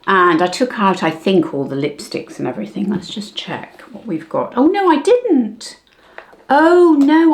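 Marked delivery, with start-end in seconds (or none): 4.6-7.3 sudden high, louder surprise; delighted oh no, much higher; surprised. Real, not acted. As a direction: caught off guard by her own memory. Finds lipsticks she thought gone.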